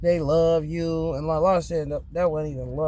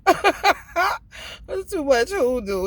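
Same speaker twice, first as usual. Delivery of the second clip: laughing; 10 semitones higher, 4 dB louder, much slower than usual. Real, not acted. Laughing while talking.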